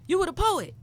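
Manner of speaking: high, excited imitation of a fan; impressed audience member. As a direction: gush like an admiring audience member. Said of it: "they were like".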